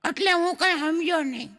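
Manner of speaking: put-on high, eager kid calling his papa; little boy's voice. A small boy calls out to his father, high and eager. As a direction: pipe up like a small curious boy.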